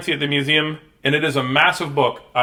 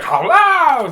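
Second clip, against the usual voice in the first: high, loud mock call; playful holler; 14 semitones higher, 8 dB louder than usual. Nancy as zombie calls Bree over, playful holler. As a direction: holler it out, hammed-up.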